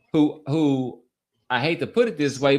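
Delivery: ordinary talk, nothing marked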